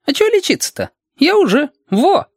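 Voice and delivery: put-on very high, lively child voice; protesting child. Reshka showing Alexander he is well, very high child voice.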